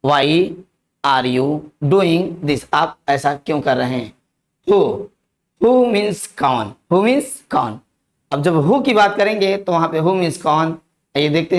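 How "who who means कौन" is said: slow, deliberate word-by-word model; slow teaching demo. Shown as a demonstration. Hindi English teacher modelling who for students, slow and deliberate. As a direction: lay each word out slowly for learners.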